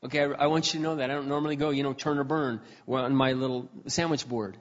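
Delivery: normal talking voice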